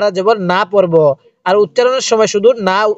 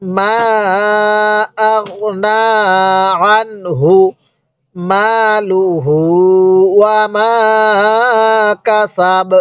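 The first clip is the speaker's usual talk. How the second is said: slow phrases with long steady vowels; drawn-out teaching recitation; model Quran recitation; much slower than usual. Shown as a demonstration. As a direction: recite measuredly, holding each elongation. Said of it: "তাহলে কিভাবে পড়ব?".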